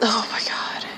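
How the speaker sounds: exasperated whisper; a real weary whisper. Real, not acted. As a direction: breathe it out under your breath. Exasperated aside after story.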